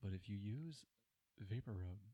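lightly voiced Hank Hill, soft and low; a hint of Hank Hill advice; Hank Hill impression. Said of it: "almost like a Hank Hill impression".

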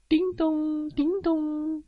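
high, ringing "ding-dong"; doorbell imitation. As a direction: sing out a doorbell chime.

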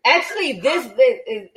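laughing. Real, not acted. Laughing after being teased.

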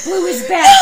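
high, loud laughing yell; mock furious shriek; laughing outraged shout. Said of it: "so pissed".

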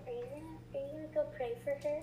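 whispered nudge, high and fast; mock-whispered prod from a friend; hushed question. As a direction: lean in and ask under your breath.

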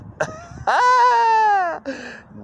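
high, loud, held laugh; piercing burst of laughter; cackle. Real, not acted. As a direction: let out a shrieking long laugh. Laughs at his own slogan.

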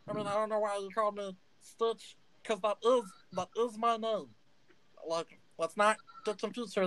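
a little gnome spiralling into a crisis; panicky gnome Stitch. Acted. Gnome Stitch freaks out that a stranger guessed his name.

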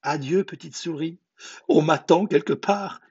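high-pitched hasty farewell; frightened excuse. Acted. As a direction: make excuses as the scared fox. Frightened fox makes excuses.

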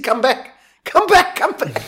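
high, loud, frantic calling; calling after the shark as it swims away; desperate pleading. Retold or imitated. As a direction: beg the shark to return, voice cracking upward. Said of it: "in tears".

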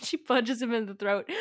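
real laugh around the words. Real, not acted. Teller amused by the punch in the story, laughing. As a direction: say it through a laugh.

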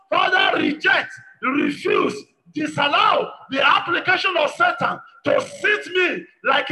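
loud, emphatic preaching prayer in short bursts; forceful prayer leading. Real, not acted. Preacher pleading with God to reject Satan's plans, forceful prayer. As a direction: cry out each petition with insistence.

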